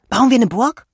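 high, loud, excited question; girl eagerly inviting a new friend to build a castle; eager invitation. A narrator's reading. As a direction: bounce it out, keen to play. Maxi eagerly invites Lilian.

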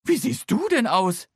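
high, loud astonished question; staring at the outfit in disbelief; mother taken aback, voiced high and loud; Mama astonished. A narrator's reading. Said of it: "fragt Mama".

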